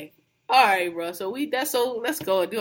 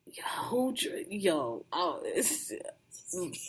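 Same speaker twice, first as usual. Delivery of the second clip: hushed retold whisper, words trailing off; softer mock whisper, half-swallowed; 4 semitones lower, 10 dB quieter, slower than usual. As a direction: breathe it under your breath as if caught out. Hiding laughter, retold aside.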